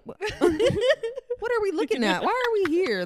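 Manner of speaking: high, loud, baffled outburst; retold bewilderment. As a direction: stretch it out high in mock disbelief. Retold bewilderment at seeing only darkness.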